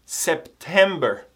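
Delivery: slow model word. Shown as a demonstration. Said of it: "Repita depois de mim".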